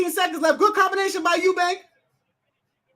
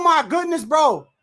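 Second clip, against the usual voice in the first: ordinary talk, nothing marked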